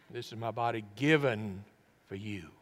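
weight on "given", fading away after it; stress on GIVEN. Shown as a demonstration. Preacher trying Jesus' possible inflection, stress on GIVEN. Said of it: "I wonder what he emphasized the most".

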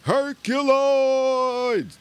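long drawn-out high cry of the name; excited shout. Retold or imitated. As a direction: belt the show title like a cartoon intro.

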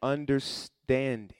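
slow, stretched out; drawn-out answer. Shown as a demonstration. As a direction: draw the word out for them.

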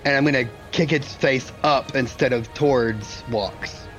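usual speaking voice

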